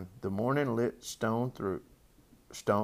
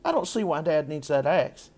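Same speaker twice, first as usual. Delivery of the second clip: put-on higher little-girl voice; puzzled child, pitched up; eight-year-old Fern; 6 semitones higher, 4 dB louder than usual. A narrator's reading. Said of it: "continued Fern, who was only eight".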